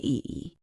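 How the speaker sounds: ee said as a long full vowel; wrong full vowel. Shown as a demonstration. Teacher showing Spanish learners the vowel to avoid, long full ee. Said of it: "We don't say".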